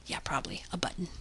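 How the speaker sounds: fast whispered aside; whispering. Real, not acted. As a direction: whisper it to yourself quickly. Aside while coding.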